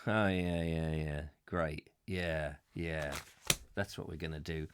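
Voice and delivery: low, quiet, casual agreement; hushed, low, unquestioning yes-man; acted willing compliance. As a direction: play a henchman quietly going along with it. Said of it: "some people getting there going".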